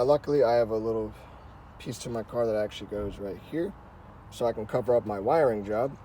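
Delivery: ordinary delivery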